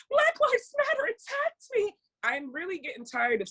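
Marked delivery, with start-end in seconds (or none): 0.1-1.9 very high, whiny fake victim; mocking the woman. Mocking the replayed news clip, very high whiny fake victim. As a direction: shriek it like a faker. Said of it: "they replayed her go".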